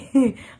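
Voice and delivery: a full open laugh; loud laugh. Real, not acted. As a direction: burst out laughing. Laugh alone on line.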